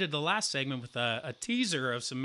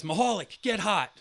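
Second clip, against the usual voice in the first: loud coach call, raised pitch; 6 semitones higher, 4 dB louder than usual. Retold or imitated. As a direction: bark it across the dugout. Coach calls from dugout.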